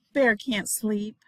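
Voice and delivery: usual speaking voice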